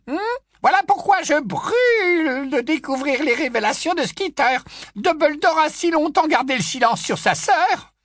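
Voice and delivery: put-on high, loud old busybody voice; high loud tipsy old aunt gushing; old aunt Muriel.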